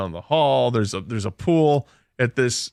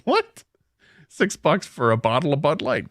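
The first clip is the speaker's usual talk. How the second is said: incredulous retold what; 2 semitones higher than usual. Hotel guest reacting to the price of two beers, incredulous.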